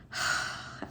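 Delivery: soft, voiceless acted sigh; imitated sigh. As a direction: let out a put-upon sigh, mimicking him. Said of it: "like, sighed".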